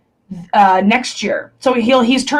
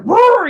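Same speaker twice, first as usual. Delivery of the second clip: high, loud playful roar; mock roar; 11 semitones higher, 4 dB louder than usual. Showing how big and burly her dad is, a playful mock roar. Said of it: "burly dude".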